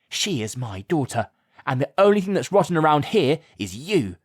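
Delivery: a mother hitting back; defiant. A narrator's reading. Mum hits back at the lady, defiant. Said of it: "said Mum defiantly".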